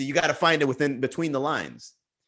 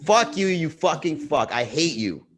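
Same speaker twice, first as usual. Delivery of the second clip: slow exaggerated insult; 3 semitones higher, slower than usual. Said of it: "Somebody could say".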